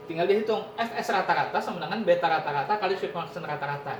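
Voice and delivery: normal talking voice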